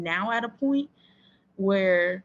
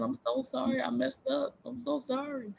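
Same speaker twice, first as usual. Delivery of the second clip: retold tearful apology; 6 semitones higher, 4 dB quieter than usual. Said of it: "boo-hoo crying".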